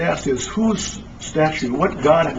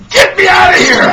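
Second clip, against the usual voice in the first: much higher, louder cry to get out; retold cry for help; 11 dB louder than usual. Trapped people crying out to be let out, loud cry. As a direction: shout it like you're trapped. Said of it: "they're going".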